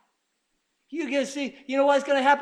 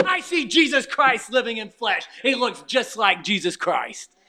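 normal talking voice

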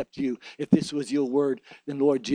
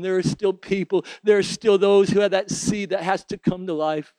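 usual speaking voice

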